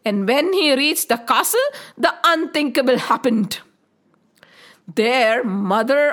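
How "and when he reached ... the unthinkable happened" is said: plain reading voice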